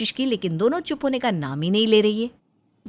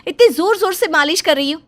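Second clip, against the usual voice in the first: much higher and louder, distressed mother; alarmed protest; 10 semitones higher, 7 dB louder than usual. Acted. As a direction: cry out to stop her hurting the baby. Mother protests rough massage. (बच्चे के लिए घबराकर ऊँची आवाज़ में टोकती माँ)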